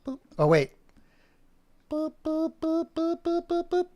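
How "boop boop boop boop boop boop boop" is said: high cartoon beeping boops. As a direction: chirp like a toy dog. Voiceover dog sounds.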